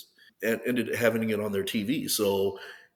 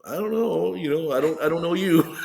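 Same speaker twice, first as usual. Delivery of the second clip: high-pitched mock confusion breaking into a laugh; amused bafflement; 8 semitones higher, 5 dB louder than usual. Athlete answers strangers who know him, baffled and amused. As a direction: replay being puzzled, amused at yourself. His baffled reply, retold.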